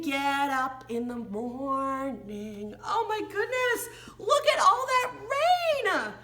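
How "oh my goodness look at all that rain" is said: ordinary talk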